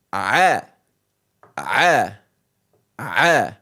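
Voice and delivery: a, a, a low from the throat; throaty ayn. Shown as a demonstration. Teacher demonstrating the tricky throat letter. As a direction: push a from the throat. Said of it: "the tricky one for English".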